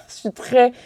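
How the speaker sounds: words through a giggle; giggly; laughing. Real, not acted. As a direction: keep laughing as you talk. Laughing mid-sentence.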